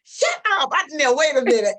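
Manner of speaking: high, loud shriek of disbelief; surprised. Real, not acted. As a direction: shout it out in real astonishment. Disbelief at her age.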